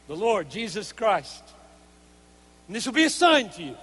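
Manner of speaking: declaimed angelic announcement; scripture proclamation. Acted. Angel brings shepherds good news of great joy, proclaimed scripture. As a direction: proclaim it to listeners, easing off at the end.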